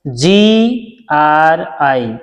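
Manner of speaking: जी आर आई spelled letter by letter, drawn out slowly; slow letter spelling. Shown as a demonstration. Teacher spelling जी आर आई slowly for beginners. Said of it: "रे का sound आएगा".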